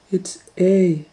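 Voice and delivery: put-on high little girl answer; Kate. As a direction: answer like a sure child.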